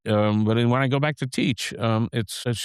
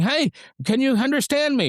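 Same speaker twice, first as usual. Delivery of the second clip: mock high strained shout over noise; high and loud; 14 semitones higher than usual. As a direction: pitch it way up like yelling over a bar. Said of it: "talking high and loud".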